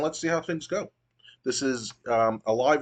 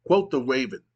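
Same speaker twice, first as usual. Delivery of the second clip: ordinary delivery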